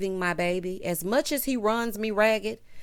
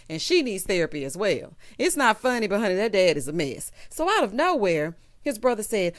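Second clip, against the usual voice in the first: ordinary talk, nothing marked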